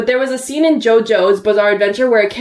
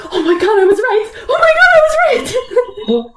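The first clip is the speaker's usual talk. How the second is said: laughing, rising much higher on the repeat; triumphant laughing; 13 semitones higher than usual. Retold or imitated. Fan gloating to her friend, high laughing triumph. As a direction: crow it, laughing, higher each time.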